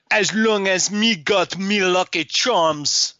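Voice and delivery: high, slow, hammed-up fake Irish lilt; bad Irish-Jamaican mashup. Actor mixing Jamaican with an Irish leprechaun, hammed-up fake Irish accent. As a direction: ham a leprechaun accent badly. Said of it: "I do an Irish thing".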